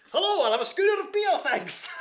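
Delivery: high, loud put-on character voice; in character in a pub. Actor ordering a pub beer, still in character. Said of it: "afterwards and say".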